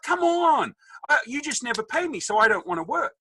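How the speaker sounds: high, indignant mock whine; protesting teenager. Son complaining to dad about pay, mock protest. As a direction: argue back as a teenage son, voice way up.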